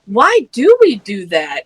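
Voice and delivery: normal talking voice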